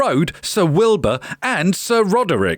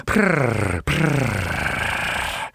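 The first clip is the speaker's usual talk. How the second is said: low, soft purring; cat purr; 9 semitones lower, 4 dB quieter than usual. A narrator's reading. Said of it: "said Wilbur".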